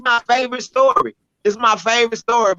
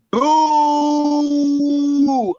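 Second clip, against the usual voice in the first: drawn-out mock boo, pitched up; crowd booing; 5 semitones higher than usual. Crowd jeering at the poet on stage, mock boo. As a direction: boo her like a restless crowd. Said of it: "the whole crowd go".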